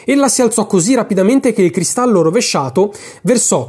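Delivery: ordinary delivery, nothing marked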